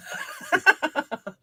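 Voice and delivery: high-pitched burst of laughter; laughing. Real, not acted. Co-host cracking up after his own takeaway, laughing.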